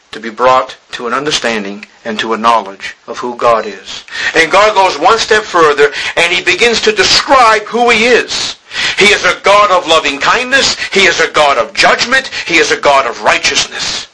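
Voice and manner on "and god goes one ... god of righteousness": full-force preaching. Acted. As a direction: proclaim it from the pulpit.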